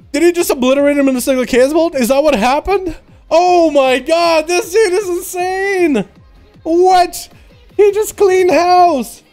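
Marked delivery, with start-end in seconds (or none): none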